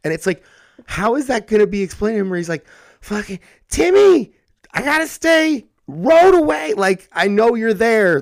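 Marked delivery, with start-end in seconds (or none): 3.0-8.2 put-on much higher, louder, slow kid voice; whiny little kid. Host imitating a kid calling to his neighbor friend, high and whiny. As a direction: wail like a small child stuck across the street.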